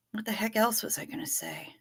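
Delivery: whispered aside to herself; breathy unvoiced murmur; whispering. Real, not acted. Streamer muttering to herself.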